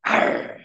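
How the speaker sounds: short voiceless growl; mock monster snarl; playful growl. Shown as a demonstration. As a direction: growl at the kids for fun. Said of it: "I used to growl at my kids".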